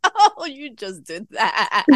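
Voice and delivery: slow words through laughter; laughing at herself. Retold or imitated. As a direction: tease yourself while laughing. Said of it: "I'm just like".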